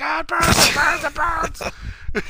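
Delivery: high, stretched wail of it burns; hammed-up cry of pain, drawn out slowly; imitating a man howling as the slide burns; mock pained scream. Character screaming in pain retold.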